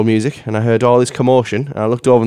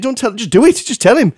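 higher-pitched retold protest; mock exasperation, pitched up; exasperated retort; 9 semitones higher than usual. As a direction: protest to the dog owner in disbelief. Exasperated reply to the dog owner.

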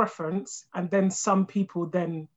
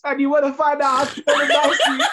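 laughing outrage; 7 semitones higher, 3 dB louder than usual. Real, not acted. Outraged laughing rant about husband.

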